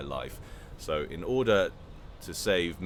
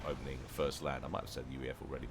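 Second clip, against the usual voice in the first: usual speaking voice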